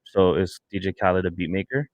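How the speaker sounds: ordinary talk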